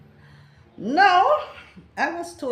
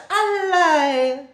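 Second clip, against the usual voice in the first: high, loud cry of A lie!; disbelief; 8 semitones higher, 10 dB louder than usual. Shown as a demonstration. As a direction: exclaim it in disbelief. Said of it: "This usually means that, no, that can't real".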